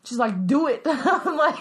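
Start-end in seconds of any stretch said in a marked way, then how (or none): 0.5-0.8 put-on insistent kid. Retold daughter insisting.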